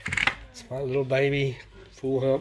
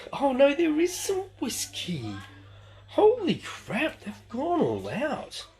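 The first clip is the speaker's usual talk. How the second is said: high, slow, astonished exclaim; delighted surprise; 14 semitones higher, slower than usual. Real, not acted. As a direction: react like you can't believe your eyes. Finds unexpected whiskey.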